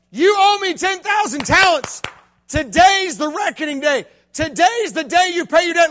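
a hint of a stern master; demanding master. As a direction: call in the debt without mercy.

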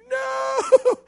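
sharp high yelp of alarm; a terrified little yelp, way up high; mock-panicked "No!"; panicked cry. Panic on seeing bully approach.

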